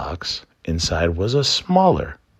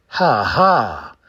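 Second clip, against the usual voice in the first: raven laugh; 5 semitones higher, 4 dB louder than usual. Acted.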